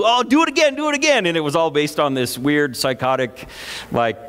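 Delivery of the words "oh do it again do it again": put-on high-pitched eager squeal of a kid; imitating an excited, twisted little brother; gleeful begging boy. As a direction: beg for more, voice shooting up high. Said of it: "and he'd go".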